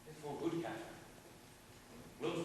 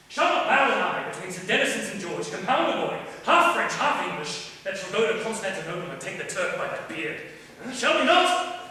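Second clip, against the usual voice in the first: excited proposal; 8 dB louder than usual. Acted. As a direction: sweep her up in the grand idea. King proposes a son excitedly.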